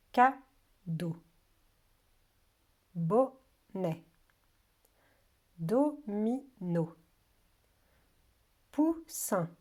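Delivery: slow word list, every syllable separated. Shown as a demonstration. Teacher names the labels for children, syllable by syllable. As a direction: dictate each word beat by beat, pausing between.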